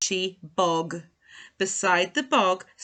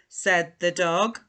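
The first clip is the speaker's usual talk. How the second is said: plain reading voice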